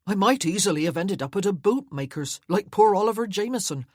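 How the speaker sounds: put-on loud, fast, eager young footman; eager chatter. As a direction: gush on happily like a young servant.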